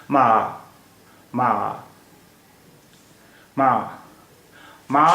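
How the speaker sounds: teacher slides "मा" downward; tone demo ma. Shown as a demonstration. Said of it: "आवाज़ ऊपर से शुरू हुई और नीचे की तरफ आ रही है".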